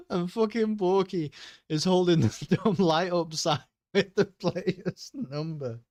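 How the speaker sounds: high, helpless laughter breaking into the words; real laughing fit. Real, not acted. Streamer finishing his joke about Borky on the sideline, laughing. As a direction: struggle to finish through the giggles.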